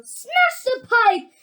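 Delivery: high, drawn-out yell. A narrator's reading. Mrs. Gloop demanding the pipe be broken, high yell. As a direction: yell the order long and high. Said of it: "yelled Missus Gloop".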